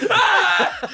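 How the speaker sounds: piercing high shriek, then laughter; imitated scream. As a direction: mimic the friend screaming in pain. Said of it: "as you're screaming".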